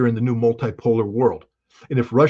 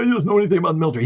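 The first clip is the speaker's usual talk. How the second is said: dismissive mock quote; 6 semitones higher, much faster than usual. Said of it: "Everybody's like".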